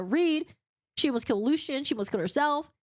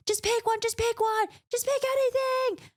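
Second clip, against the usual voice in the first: high-pitched frantic mock yell; 12 semitones higher than usual. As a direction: urge her on in a high, strained yell. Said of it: "Lucien at this point is yelling".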